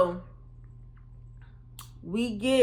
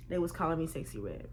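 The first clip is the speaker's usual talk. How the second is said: normal talking voice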